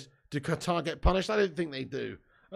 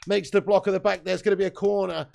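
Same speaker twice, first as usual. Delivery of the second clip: raised, lively match commentary; live play-by-play; 4 dB louder than usual. Acted. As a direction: call the action like a football commentator. Streamer calls the match live.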